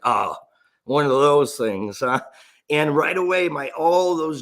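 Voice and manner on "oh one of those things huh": skeptical mom. Retold or imitated. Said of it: "my mom's like".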